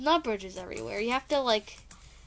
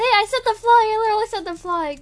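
excited shout; 10 semitones higher, 10 dB louder than usual. Real, not acted. Excited shout during gameplay.

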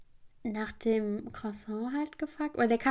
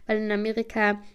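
usual speaking voice

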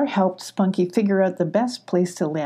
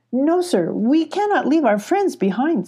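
high kitten voice, politely firm; a little cat refusing to leave friends; 7 semitones higher than usual. A narrator's reading. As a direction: kitten speaking up for friends, higher. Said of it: "said Abby".

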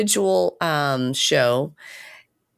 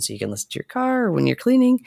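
usual speaking voice

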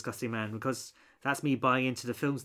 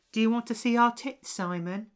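lightly imitated twins, higher pitch; British twins; 8 semitones higher than usual. Retelling how British twins approach Simon, lightly imitated. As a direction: put on a hint of the twins' come-on. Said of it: "two British twins appear and go".

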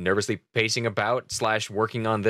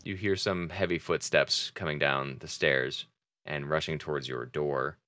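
ordinary talk

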